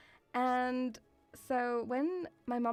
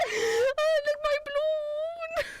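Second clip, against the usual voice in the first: very high, loud, slow mock wail; wailing kid; 6 dB louder, slower than usual. Kid running from the dog, hammed-up wail. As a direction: shriek it like a little kid, hammed-up. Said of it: "a kid running with a balloon going".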